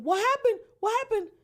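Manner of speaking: very high, loud panicked scream; imitating a sister's frantic screams. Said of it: "she started screaming".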